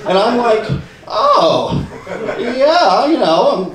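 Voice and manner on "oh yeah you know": very high, loud showy response; high-pitched, hammed-up false confidence; put-on bragging. Fake belayer replying to an admiring parent, showily.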